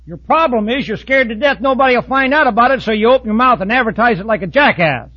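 ordinary talk

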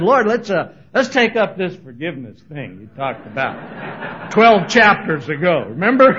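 Peter's eager call. Retold or imitated. Peter coming back to Jesus, loud and proud. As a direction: call out proudly like he's found the answer. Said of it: "comes back to Jesus and said".

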